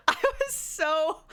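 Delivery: breaking into high laughter while talking; helpless laugh, stretching "so". Real, not acted. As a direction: laugh through the words, pitch shooting up. Laughs at how angry they were.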